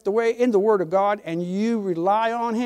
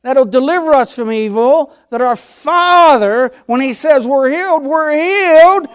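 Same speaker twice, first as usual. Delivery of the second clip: preaching at full force; 4 semitones higher, 10 dB louder than usual. Acted. Preacher exhorting the church to believe, loud and fervent. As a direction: build the congregation up to a climax.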